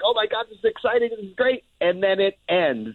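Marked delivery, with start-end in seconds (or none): 0.0-1.6 higher, fast, thrilled; mock excitement. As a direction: rattle it off like a thrilled moviegoer. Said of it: "You're like".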